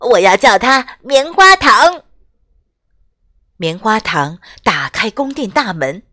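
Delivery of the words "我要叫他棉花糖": happy shout. Acted. Olaf still cheerfully calling.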